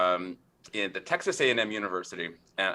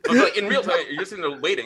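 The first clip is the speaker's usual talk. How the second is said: telling it through laughter; laughing and louder as it builds; amused, laughing retelling; 7 semitones higher, 8 dB louder, faster than usual. Real, not acted.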